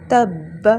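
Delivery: تب with a strong ب. Shown as a demonstration. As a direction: read تب clearly. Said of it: "آگے وقف".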